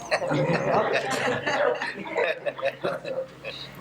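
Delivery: low, falling amused chuckle. Real, not acted. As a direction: chuckle at your own joke. Laughs after the sexy-voice joke.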